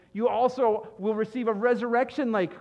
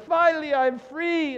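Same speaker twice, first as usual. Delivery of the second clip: loud, high "Finally, I'm free" burst of relief; relieved triumph; 8 semitones higher, 7 dB louder than usual. Pretend. Ex-partner celebrates the breakup, loud and high relief. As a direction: cheer it out like escaping.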